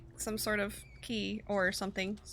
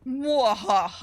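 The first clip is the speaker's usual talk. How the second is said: evil laugh; 2 semitones higher, 7 dB louder, much slower than usual. Pretend. Mock villain laugh after success.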